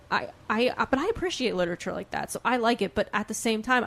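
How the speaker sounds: normal talking voice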